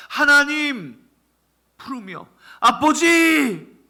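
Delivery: high loud drawn-out call to God. Acted. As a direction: cry out Father slowly. Preacher calls out to God.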